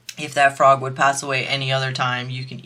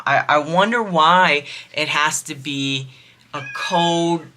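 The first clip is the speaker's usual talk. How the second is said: usual speaking voice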